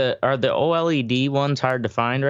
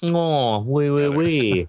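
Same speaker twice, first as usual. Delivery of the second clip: slow mock French "oui, oui, oui"; hammed-up French accent, drawn out; 4 semitones higher, much slower than usual. Mock French after France joke.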